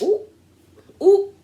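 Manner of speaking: high, drawn-out teasing "ooh"; a playful, high-pitched gasp of delight. Real, not acted. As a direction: ooh like the drama just got juicy. Reacting to the table drama.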